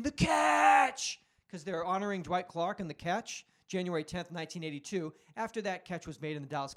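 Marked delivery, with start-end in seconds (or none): 0.0-1.2 high, loud imitated fan scream. Imitating fans walking into the bathroom, screaming. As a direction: holler it like a drunk fan in an echoing room. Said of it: "you start screaming".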